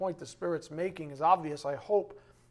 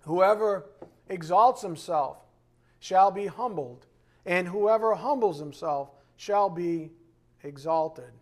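louder, slow, each phrase hammered; preaching scripture; 5 dB louder, slower than usual. Acted. Pastor proclaiming Matthew to the church, slow and loud. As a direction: proclaim it slowly from the pulpit.

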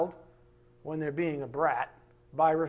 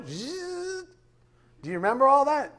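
ordinary talk

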